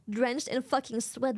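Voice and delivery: ordinary talk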